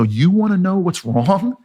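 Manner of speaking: high, slow, indignant retort; exasperated venting. Retold or imitated. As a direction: draw it out as a fed-up challenge. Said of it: "I vented on her".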